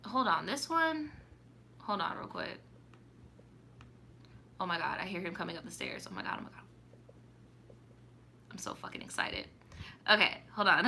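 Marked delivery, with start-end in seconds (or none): 1.8-9.4 hushed, giddy whisper; excited whisper. Real, not acted. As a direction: whisper it so he can't hear you coming. Hiding excitement as he comes upstairs.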